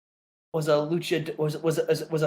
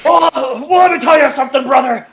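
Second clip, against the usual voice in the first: high, loud, fast hammed-up promo shout; hammed-up shouted wrestling promo; wrestler promo shout; 10 semitones higher, 12 dB louder than usual. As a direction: bellow it like a cartoonish wrestling legend.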